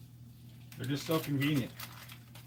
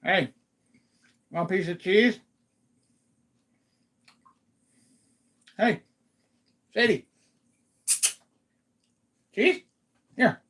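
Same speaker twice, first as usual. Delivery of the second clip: high, sing-song coaxing with a treat; coaxing the dog; 7 semitones higher, 3 dB louder than usual. Real, not acted. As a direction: tempt the dog with cheese.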